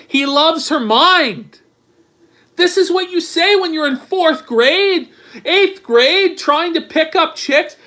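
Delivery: high, loud, scornful rant; worked-up mocking disbelief; incredulous ridicule. Real, not acted. Ridiculing the man's love line.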